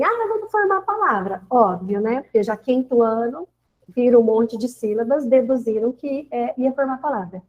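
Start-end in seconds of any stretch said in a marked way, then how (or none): 0.0-1.4 lightly voiced child, pitched up; higher voice for a pupil's eager answer; imitating pupils. Pupils guessing the teacher's task, lightly imitated children. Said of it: "eles falaram assim".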